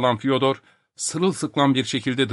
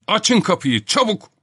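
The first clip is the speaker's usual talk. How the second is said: high-pitched, urgent order; urgent command; 10 semitones higher, 3 dB louder than usual. Acted. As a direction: demand that they open up at once. Professor urgently orders locked door opened. (telaşlı, tiz sesle emir)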